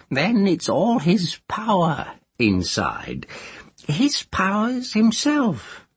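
put-on high, teasing old witch; a cackling village witch. A narrator's reading. Moss voiced.

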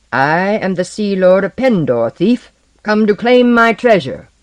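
loud, haughty proclamation; arrogant sea lord. Acted. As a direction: claim the treasure grandly. Blackbeard declares himself.

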